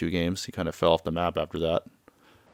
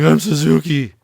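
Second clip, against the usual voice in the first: raised, loud mock protest; insistent denial; 8 semitones higher, 7 dB louder than usual. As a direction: insist on it loudly, pitched up. Kiryu insists on his alias.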